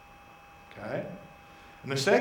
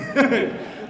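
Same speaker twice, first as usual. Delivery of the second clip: short laugh; 9 semitones higher, 5 dB louder than usual. Real, not acted. Laughs at himself.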